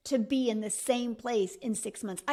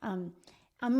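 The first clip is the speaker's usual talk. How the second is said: usual speaking voice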